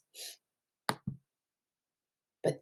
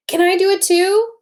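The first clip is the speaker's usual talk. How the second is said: put-on high, loud eager kid; eager child; 11 semitones higher, 5 dB louder than usual. Teacher voicing Tom asking to mix too, high and eager. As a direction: ask to join in like an excited child.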